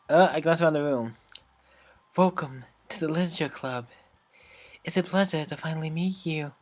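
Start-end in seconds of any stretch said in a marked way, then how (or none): 2.2-6.5 put-on quiet, gentle shy girl voice; soft shy girl. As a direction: speak softly like a shy bookworm. Voicing shy Yuri.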